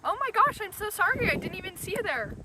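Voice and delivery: startled. Acted. Startled by someone behind her.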